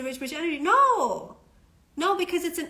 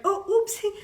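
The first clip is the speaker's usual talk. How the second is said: a high, louder, hammed-up cutesy oopsie with a fake cry; mock oopsie; 7 semitones higher, 4 dB louder than usual. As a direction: overplay a fake innocent whimper. Mocking a fake-innocent reaction.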